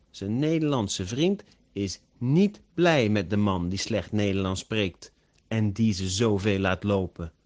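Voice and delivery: ordinary delivery, nothing marked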